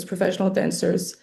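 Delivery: ordinary talk, nothing marked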